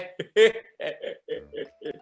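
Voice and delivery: imitated cackling laugh; Eddie Murphy laugh. Imitating Eddie's famous laugh, cackling. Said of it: "I'll do the laugh".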